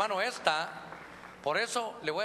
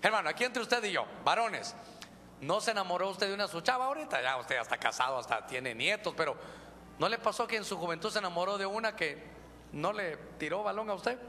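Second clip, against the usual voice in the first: ordinary delivery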